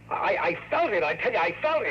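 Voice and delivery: desperate insisting. Acted. Don insisting to Kathy he was shot, desperate. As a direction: make her believe you felt the bullet.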